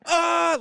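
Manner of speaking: loud, high yelp of fright; mock scream of a startled theater crowd; imitated audience scream. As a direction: scream like a jump scare just hit. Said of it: "the whole theater doing that".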